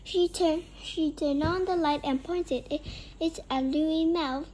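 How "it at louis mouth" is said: plain reading voice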